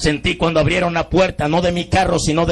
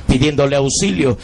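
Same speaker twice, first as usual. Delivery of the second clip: ordinary talk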